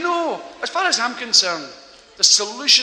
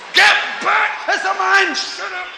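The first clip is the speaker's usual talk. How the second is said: much higher, louder angry shouting; yelling father; 12 semitones higher, 4 dB louder, slower than usual. Acted. Father yelling at his kids behind door, angry shout. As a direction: bellow at the noisy kids.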